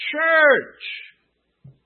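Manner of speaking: loud, high burst on one word; emphatic exclamation. Real, not acted. Preacher answering his own question to the congregation, loudly exclaimed. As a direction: call out the obvious answer.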